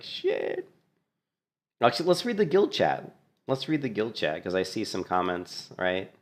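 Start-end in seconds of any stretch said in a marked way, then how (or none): 0.0-0.7 whispered curse. Retold or imitated. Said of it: "He's like".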